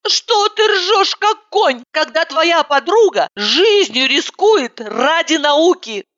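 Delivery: high, slow, hurt reproach; offended. Acted. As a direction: take offence at her laughing.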